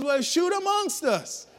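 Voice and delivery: high, loud hammed-up holler; desperate yelling. John stuck with the polecat to his friends, desperate mock yell. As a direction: beg loudly from the treetop, played for laughs.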